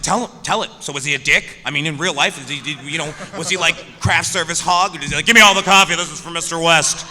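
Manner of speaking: loud, laughing, high gossip-hunting; giddy fan begging for stories; pushy eager. Retold or imitated. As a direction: egg him on for dirt. Pestering for gossip.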